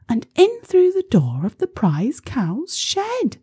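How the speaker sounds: high-pitched little ladybird voice; small creature telling the plan, high. A narrator's reading. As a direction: pipe up high to warn the others. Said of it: "the little spotty ladybird".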